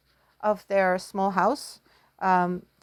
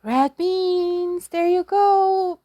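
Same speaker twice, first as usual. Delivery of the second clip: pleased; 8 semitones higher, 4 dB louder, slower than usual. Real, not acted. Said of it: "teacher confirms answer".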